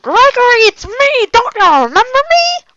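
high, loud pleading reunion; calling out to long-lost ghost friend, high and loud; desperate plea. Acted. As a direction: beg him to remember you.

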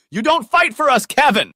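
high, loud yell of reproach; dramatic yell. A narrator's reading. Young woman arguing with her boyfriend, high dramatic yell. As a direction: yell it high and hard, like a soap-opera row. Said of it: "One time she yelled".

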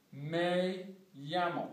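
"Me llamo" slow, llamo with a Y sound; Me like "may", "llamo" like "yamo"; Spanish me llamo. Shown as a demonstration. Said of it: "This sounds like a Y".

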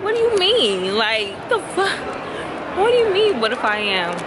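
high, loud disbelief, voice jumping up; shocked and offended, pitch shooting high; incredulous outburst. Retold or imitated. To her mom, outraged by the question, high loud disbelief. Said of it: "I'm like".

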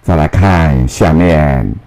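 normal talking voice

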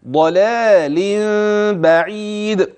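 ضلال بعيد chanted with thick ض and thin ل; weight the ض of ضلال, lighten both lāms; heavy ḍād, light lām. Shown as a demonstration. Teacher demonstrating heavy ض then light ل in ضلال بعيد. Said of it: "ولاحظ الضاد المفخمة واللام بعدها مرققة".

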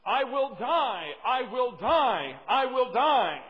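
loud, high, drawn-out desperate cry; screaming in panic. Acted. Drowning boy screams from the stream, loud panicked yell. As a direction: cry it out slowly at full volume. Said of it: "he screamed at the top of his lungs".

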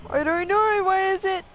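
high, loud, anxious reply; alarmed. Real, not acted. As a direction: throw the question back in alarm. Night falls, players alarmed.

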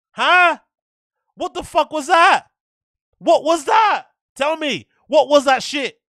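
loud, high-pitched angry demands; outraged disbelief. Real, not acted. Streamer outraged at the quality of the fights, shouting.

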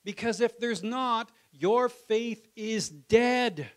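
slow, loud, high solemn warning; imitating James. James warning the church that faith is dead, slow and loud. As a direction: drag it out like a stern preacher. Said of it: "James is like".

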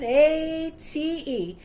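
spelling it out. Acted. Auctioneer spelling out mockingly.

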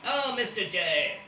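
high, slow robot voice; robot maid voice. Retold or imitated. Cartoon robot maid greeting her boss, high and slow.

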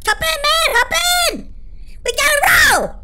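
high excited shout to hop in; excited call. Real, not acted. Gamer calling friends into the getaway car, high excited shout. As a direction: yell for the crew to jump in.

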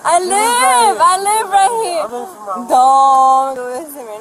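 high, loud, crying plea; tearful pleading. Real, not acted. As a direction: beg through tears, voice up high. Begging officer not to arrest.

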